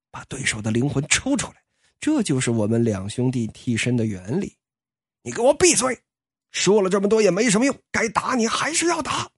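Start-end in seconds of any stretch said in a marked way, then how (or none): 5.2-9.3 angry shout. Acted. Angry outburst at the villain.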